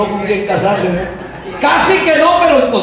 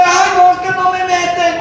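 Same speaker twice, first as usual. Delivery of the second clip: mock whine; 6 semitones higher than usual. Mocking excluded youth's whine.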